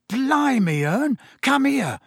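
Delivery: high, urgent cockney call to his mate; excited shout. A narrator's reading. Stan calls the driver over in a high excited shout. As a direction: yell for Ern, amazed. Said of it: "Stan shouted".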